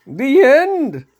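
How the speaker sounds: high, loud squealing fish voice; screaming fish. A narrator's reading. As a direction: shriek it like a panicked talking fish. Fish repeats its warning.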